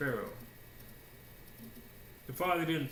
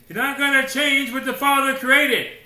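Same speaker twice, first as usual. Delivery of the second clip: loud, high, ringing pulpit declaration; preaching at full force; 12 semitones higher, 14 dB louder than usual. Real, not acted. Preacher hammering the point to the congregation, full voice. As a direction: drive it home to the congregation.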